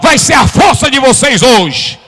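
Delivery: high, loud, slowed shouting; full-force preaching. Acted. Preacher to congregation, shouting the message. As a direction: thunder it over the congregation.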